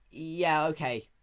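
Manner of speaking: put-on a hasty young dragon's yeah okay; young dragon quickly agreeing. A narrator's reading. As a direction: answer quickly to get away. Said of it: "Spike said quickly".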